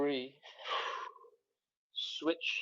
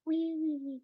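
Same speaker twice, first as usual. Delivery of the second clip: playful whee; 5 dB quieter than usual. Real, not acted. Playful fold at the waist.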